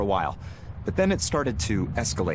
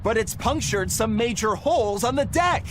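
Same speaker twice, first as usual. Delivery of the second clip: indignant protest; 10 semitones higher than usual. Acted. Protesting the damage to deck.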